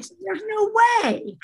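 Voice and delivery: high-pitched mock disbelief; incredulous denial. Husband refusing to believe wife is pregnant, high incredulous denial. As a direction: imitate someone who can't believe it, pitch soaring.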